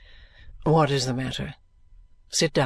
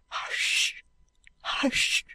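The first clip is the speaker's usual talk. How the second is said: whispered fear; 4 dB quieter than usual. Acted. Housekeeper hushes in fear.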